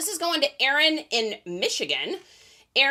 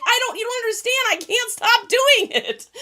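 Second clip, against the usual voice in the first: high, whining retold plea; hammed-up high-pitched desperation; exasperated protest; 11 semitones higher, 4 dB louder than usual. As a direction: wail it as if nobody gets it, slowing at the end. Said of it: "And I'm like".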